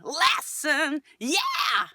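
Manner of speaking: sung "Lesson" with bright twang; twang demo. Shown as a demonstration. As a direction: twang all the way down, not too loud. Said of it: "Like that".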